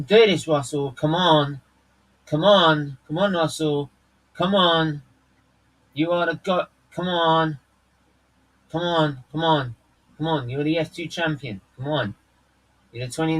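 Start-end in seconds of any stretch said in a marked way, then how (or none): none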